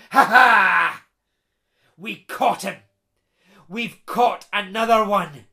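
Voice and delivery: loud gloating bogle king; triumphant cry. Acted. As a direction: crow over the captured boy. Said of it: "the King of the Bogles cries out".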